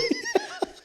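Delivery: high, sheepish laugh; real laugh. Real, not acted. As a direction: laugh at your own blunder. Laughing about wife's reaction.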